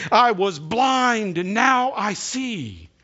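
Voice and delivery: loud, slow proclamation; healed man's testimony. Acted. Healed blind man declaring his sight at the wall, slow and loud. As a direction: declare it slowly and fully. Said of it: "And another man said".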